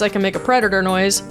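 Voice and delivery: normal talking voice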